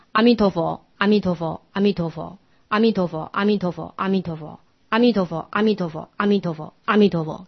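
"阿弥陀佛" recited in groups of three, three and four; counted recitation of "阿弥陀佛", each group stepping down in volume. Shown as a demonstration. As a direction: chant "阿弥陀佛" evenly, pausing after the third and sixth. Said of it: "十句成片三三四".

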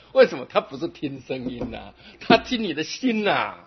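ordinary talk

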